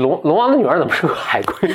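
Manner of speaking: ordinary talk, nothing marked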